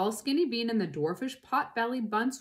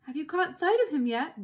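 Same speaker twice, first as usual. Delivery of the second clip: raised, higher questioning call; calling back; 7 semitones higher, 3 dB louder than usual. A narrator's reading. Bean asking the other farmers, mildly raised call. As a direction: call across to the other driver. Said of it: "Bean called back".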